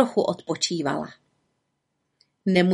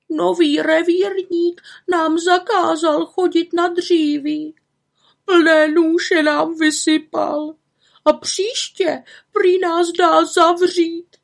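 high, slow, weepy grannies' voice; old grannies crying over their lost firewood; tearful old women; 10 semitones higher, 4 dB louder, slower than usual. Acted. Grannies complaining to the witch, tearful and high.

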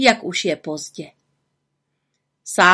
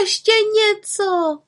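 put-on much higher, slow pleading child voice; children begging; 13 semitones higher than usual. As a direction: beg for more like an eager kid. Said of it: "prosili znovu a znovu".

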